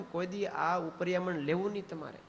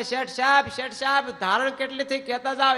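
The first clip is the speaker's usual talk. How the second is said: high, loud calls after the merchant; calling out; 9 semitones higher, 7 dB louder than usual. Retold or imitated. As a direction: shout after the departing boss.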